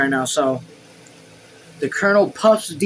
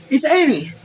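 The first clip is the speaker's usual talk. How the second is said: much higher servant voice; servant Joseph; 12 semitones higher, 4 dB louder than usual. A narrator's reading. Joseph reporting on Annie, high servant voice. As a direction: pipe up high with news.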